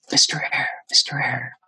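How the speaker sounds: hushed, whispery imitation of a faint voice; quiet voice. Quiet voice in his headphones trying to interrupt, hushed. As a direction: call the name softly, nearly whispered. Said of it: "this quiet voice like".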